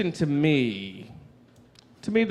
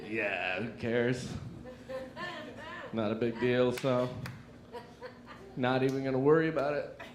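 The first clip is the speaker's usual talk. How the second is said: much lower and softer, trying to act casual; low cool mumble; 3 semitones lower, 10 dB quieter than usual. Retold or imitated. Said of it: "standing on the platform like".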